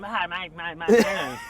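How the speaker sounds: put-on "mate" repeated in a broad Australian accent. Imitating Australians greeting him, Australian accent. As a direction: ham up an Aussie greeting, "mate, mate, mate". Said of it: "They say".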